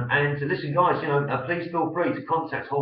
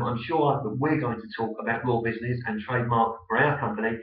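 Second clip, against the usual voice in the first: ordinary delivery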